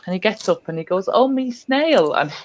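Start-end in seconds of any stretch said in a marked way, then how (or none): 1.1-2.1 put-on little boy voice. Boy's quote in story.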